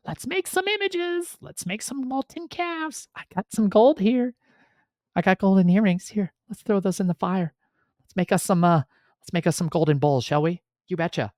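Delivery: high silly crowd voice; mocking the people. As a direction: ham up the crowd scheming. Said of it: "the people of the land at Sinai are like".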